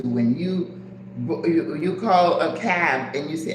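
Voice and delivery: normal talking voice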